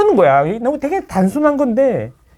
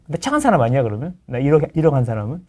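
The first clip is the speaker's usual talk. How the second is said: ordinary talk, nothing marked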